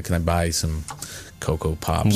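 put-on American accent, lightly done. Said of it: "in an American accent".

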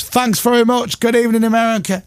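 high-pitched TV host greeting; Vernon Kay. Retold or imitated. Vernon opening his live American show, presenting. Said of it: "And Vernon goes".

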